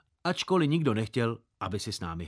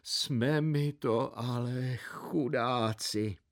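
slow, softer mock wail of woe; a hammed-up lament over lost homes; 4 dB quieter, much slower than usual. Burnt-out villagers bemoan their loss in a slow mock lament. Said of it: "Obyvatelé bědovali".

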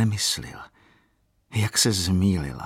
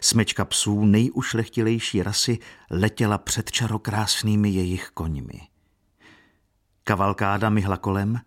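ordinary delivery, nothing marked

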